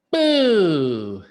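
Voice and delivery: sudden loud boo to scare. Acted. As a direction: jump out and shout boo. Lance scares his father.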